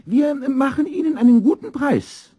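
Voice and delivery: very high, loud shopkeeper's wife voice; hasty Frau Dix. A narrator's reading. Shopkeeper's wife hurriedly offering student a deal, hasty. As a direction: jump in hastily in a high-pitched woman's voice. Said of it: "sagte Frau Dix da hastig".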